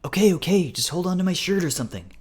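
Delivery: flustered, high-pitched okay; exasperated giving in. A narrator's reading. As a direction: give in hurriedly, pitch up. Carl gives in to scolding.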